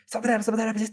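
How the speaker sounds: high, frantic squirrel chatter; squeaky little creature babbling high; excited squirrel. Acted. Game master replays the squirrel's report, high excited chatter. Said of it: "and he was like".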